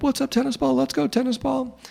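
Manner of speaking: high-pitched mocking taunt; heckling guy's voice. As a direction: imitate an obnoxious fan in a high voice. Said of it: "this guy's voice became, like, a trigger".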